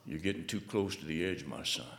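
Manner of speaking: soft, low fatherly caution; gentle warning voice. Retold or imitated.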